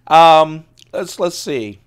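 ordinary talk, nothing marked

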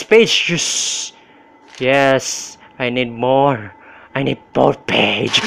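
normal talking voice

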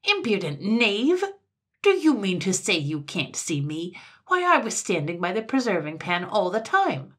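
loud, indignant scolding; outraged little tyrant; angry. A narrator's reading. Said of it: "Sir Buzz grew angry".

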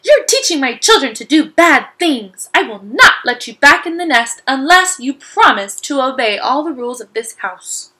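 stern scolding. Acted. Mama Bird scolds Stellaluna.

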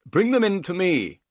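loud, high call from another room. A narrator's reading. Master calling from inside the house to the servant, calling out. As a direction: call the order out, pitched high. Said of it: "the voice called".

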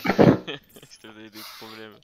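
laughing slow taunt. Real, not acted. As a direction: laugh through the words.

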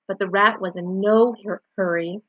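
usual speaking voice

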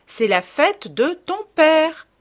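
high, slow, insistent nagging; un peu irrité. Retold or imitated. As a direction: drill it in, mildly exasperated mother. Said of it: "d'un ton un peu irrité". (ton un peu irrité, lent et appuyé)

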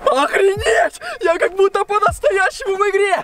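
high excited cry; amazed. Acted. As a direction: squeal with amazement. Amazed inside the game.

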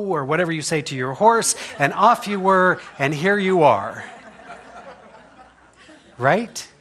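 ordinary delivery

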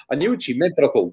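ordinary talk, nothing marked

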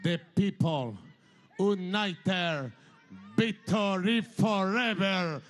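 put-on "The people united, victory forever" in English with a heavy Spanish accent, "victory" said "bitori"; quoting his own English slogan, slow and Spanish-flavoured; slogan in English with a strong Latin American Spanish accent, "forever" as "foreber". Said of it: "se lo dije en inglés".

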